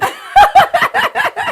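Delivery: high, loud cackle; sudden loud high laugh; real burst of laughter. Real, not acted.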